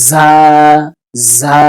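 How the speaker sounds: "ঝা" as za with a long sharp buzz; ringing z. Shown as a demonstration. Teacher modelling the letter, "ঝা" as a buzzing za. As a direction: let the z in "ঝা" whistle. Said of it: "স্যানসান করবে".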